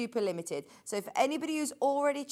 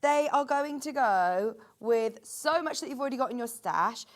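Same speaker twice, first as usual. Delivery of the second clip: ordinary talk, nothing marked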